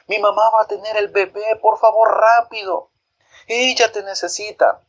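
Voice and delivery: high desperate call; calling from afar. A narrator's reading. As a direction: beg the neighbour to hurry. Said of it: "gritando desde lejos decía".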